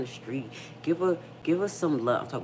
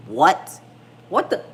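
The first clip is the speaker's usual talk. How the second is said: loud, shocked what; 3 semitones higher, 10 dB louder, slower than usual. Retold or imitated. Mom hearing the teen wants a baby, shocked. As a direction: bark it out in disbelief. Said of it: "I said".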